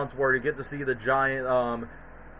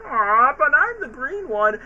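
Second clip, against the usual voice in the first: high, slow, let-down Kermit voice; disappointed Kermit; 9 semitones higher, 3 dB louder, slower than usual. Retold or imitated. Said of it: "And then Kermit gets disappointed".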